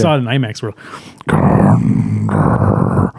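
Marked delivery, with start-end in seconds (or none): 1.3-3.1 very low whispered Gondor; deep demonic whisper. Retold or imitated. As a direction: growl it in a whisper. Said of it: "it sounds like that too".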